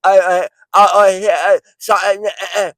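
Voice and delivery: high, loud, mush-mouthed stammer; mock them with senseless slurred noises; stupid-talk imitation. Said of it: "You sound stupid when you talk like that".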